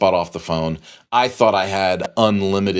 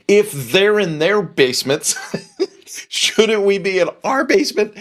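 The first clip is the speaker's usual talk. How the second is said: wife's quote broken by a laugh; laughing high question to her husband; 11 semitones higher than usual. Retold or imitated. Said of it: "And she goes".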